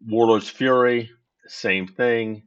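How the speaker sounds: normal talking voice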